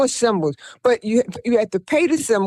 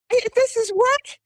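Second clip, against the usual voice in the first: amazed question; 12 semitones higher than usual. Retold or imitated. Her own retold amazement.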